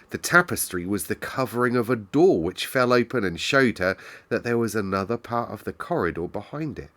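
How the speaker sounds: ordinary delivery, nothing marked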